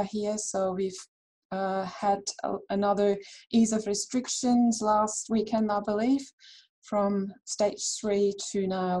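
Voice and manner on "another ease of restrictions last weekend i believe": ordinary delivery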